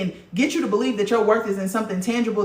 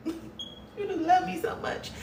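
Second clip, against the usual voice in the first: much higher, softer and tender-sounding; touched gush; 10 semitones higher, 10 dB quieter than usual. Real, not acted. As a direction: sigh it out fondly and high. Touched by the gift.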